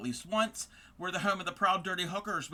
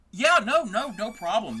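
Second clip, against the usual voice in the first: sudden high, loud yeah no; eager reassurance; 5 semitones higher, 7 dB louder than usual. Real, not acted. Streamer reassuring a raider who grabs a bite, eager. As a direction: jump in to reassure them.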